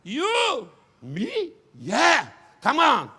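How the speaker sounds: high, loud beckoning shouts; excited pastor calling someone up; calling forward. Retold or imitated. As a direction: wave him up with your voice.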